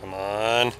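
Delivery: usual speaking voice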